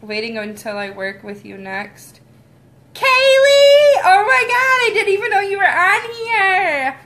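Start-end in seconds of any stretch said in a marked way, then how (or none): 2.9-7.0 excited squeal. Real, not acted. Greeting a friend seen in chat.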